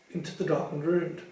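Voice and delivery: ordinary delivery, nothing marked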